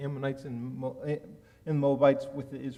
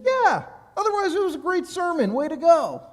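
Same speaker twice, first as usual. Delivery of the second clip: much higher, louder, chipper voice; breezy boss; 14 semitones higher, 8 dB louder than usual. Retold or imitated. Senior rabbi cheerfully praising the sermon, oblivious and chipper. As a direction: play the clueless cheerful mentor.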